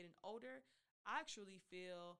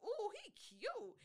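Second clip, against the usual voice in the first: excited swoon; 13 semitones higher, 6 dB louder, slower than usual. Retold or imitated. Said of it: "I was like".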